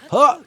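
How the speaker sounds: high, loud jump grunt; sharp yelled effort noise; yelled "huh". Shown as a demonstration. Player doing a cool guy flip jump, yelled huh. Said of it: "you have to yell".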